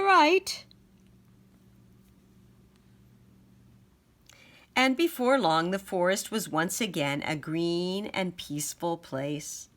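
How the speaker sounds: put-on bright, loud read-aloud to children; lively picture-book narration; storybook reading. A narrator's reading. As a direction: read it out big for the little ones.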